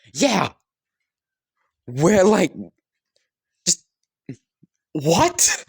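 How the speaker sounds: high, loud confused yelp; baffled outburst. Acted. Son splutters at his mother's answer, high and baffled. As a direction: splutter in disbelief. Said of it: "Bakugo would just kind of be like".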